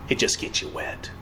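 whispered with a sneer; breathy scoffing whisper, dismissing baptism; mocking whisper. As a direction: hiss it out as a scornful jab. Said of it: "That's mocking".

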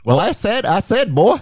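high, loud Southern cartoon rooster; booming hammed-up Southern blowhard; Foghorn Leghorn. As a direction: bluster like a cartoon rooster, stuttering restarts. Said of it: "Foghorn Leghorn?".

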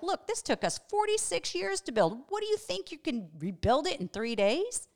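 high, incredulous scoff; scoffing religious leaders. Retold or imitated. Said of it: "And they were like".

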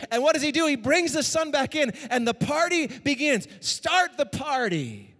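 loud, high, rousing preacher; preaching at full force. Acted. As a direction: lift the crowd to the party.

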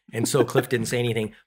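normal talking voice

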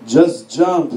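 loud, low, booming command; deep, loud mystery voice; voice from below. Acted. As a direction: boom it out from the unseen. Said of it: "you hear this voice".

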